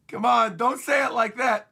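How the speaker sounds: high, loud mock dread; hammed-up pleading wail; dismayed whine. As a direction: protest in comic alarm. Characters' dismayed reaction, acted.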